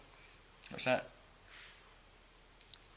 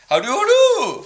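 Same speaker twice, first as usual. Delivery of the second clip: higher, louder mock-posh "How do you do"; proper posh voice; 11 dB louder, much faster than usual. Shown as a demonstration. Greeting viewers in a mocking proper posh voice. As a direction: put on a proper upper-class greeting. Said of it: "because I'm talking in a proper way".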